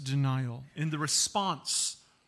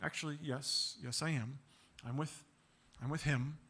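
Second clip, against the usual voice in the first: quiet admission; 3 semitones lower, 8 dB quieter than usual. Retold or imitated. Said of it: "lean on his friend and say".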